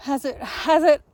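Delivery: usual speaking voice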